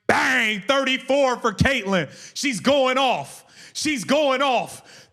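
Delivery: high, slow excited call; hype call. Real, not acted. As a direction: let it ring out, slow and high. Calling another made three.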